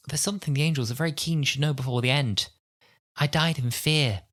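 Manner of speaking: put-on high, measured northern English accent. Quoting Angel Bob's dying words, mock northern English accent. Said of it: "That was a great accent".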